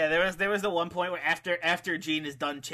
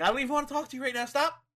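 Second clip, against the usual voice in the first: quick, higher mock huff; angry girlfriend; 8 semitones higher, much faster than usual. Angry girlfriend refusing to talk to Money, mock huff. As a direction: act the fed-up partner, rapid and high. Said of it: "an angry girlfriend".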